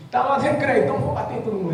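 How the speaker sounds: lightly voiced rowdy child at recess; playful child's voice. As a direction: act out a defiant schoolgirl's thought. Voicing a child's thoughts.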